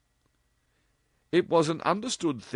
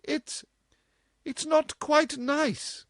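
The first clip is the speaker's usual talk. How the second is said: put-on very high, slow, hesitant older woman; flustered mother; slower than usual. A narrator's reading. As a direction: stumble over it primly.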